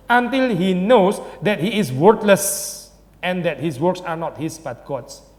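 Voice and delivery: slow, solemn reading of scripture-like text; slow quotation reading. Acted. Preacher reading Luther's thesis aloud to the church, slow and solemn. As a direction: lay out each clause slowly like a proclamation.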